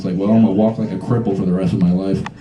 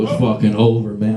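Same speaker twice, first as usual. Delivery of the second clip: ordinary talk, nothing marked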